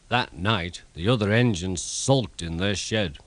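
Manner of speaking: plain reading voice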